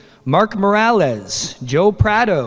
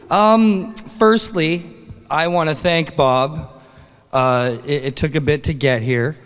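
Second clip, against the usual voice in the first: normal talking voice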